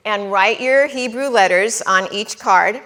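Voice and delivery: usual speaking voice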